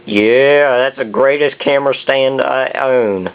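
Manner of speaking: hammed-up Southern drawl. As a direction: drawl it like a proud Southern good ol' boy. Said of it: "Sorry for the southern accent there".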